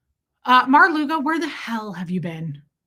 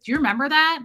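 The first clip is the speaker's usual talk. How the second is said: ordinary talk, nothing marked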